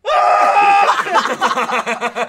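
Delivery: long, loud, high scream; hammed-up wail of pain; imitated scream. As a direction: scream as if in agony, for comedy. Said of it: "I was just like".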